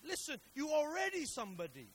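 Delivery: loud and high, building on already; pounding home that they're already somebody; rising preaching shout. Real, not acted. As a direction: preach it full force, climbing.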